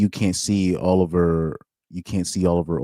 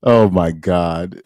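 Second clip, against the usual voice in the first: stunned disbelief; 4 dB louder, much slower than usual. Real, not acted. Reacting to massage tweet.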